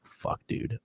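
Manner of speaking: whispered, weary curse; hushed tired whisper; whispered groan. Retold or imitated. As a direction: breathe out the dread of the long walk. Long walk back in dark.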